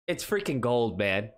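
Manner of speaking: ordinary talk, nothing marked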